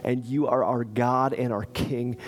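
hushed prayer to close the service; closing prayer. Real, not acted. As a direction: pray gently over the congregation. Pastor leads closing prayer.

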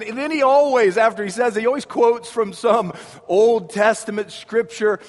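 ordinary delivery

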